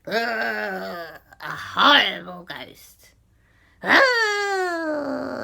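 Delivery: slow, drawn-out spooky wail; ghostly groan. Acted. Horrible ghost coming out of cat, spooky wail.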